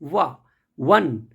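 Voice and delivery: "वन" with a clear "वा" onset; teacher demonstrates "वन" starting with "वा"; one said as wa. Shown as a demonstration. Teacher shows students "वन" sounds like "वा". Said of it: "इसका pronunciation वा है".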